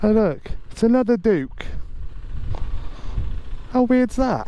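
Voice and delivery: surprised. Real, not acted. Spots another Duke bike.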